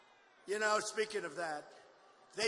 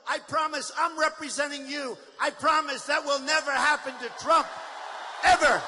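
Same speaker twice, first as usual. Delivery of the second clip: loud, high, building to a yell on Ever; acting out facing protesters, shouting defiantly; 3 semitones higher, 6 dB louder than usual. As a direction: bellow it like a vow. Acted promise to protesters.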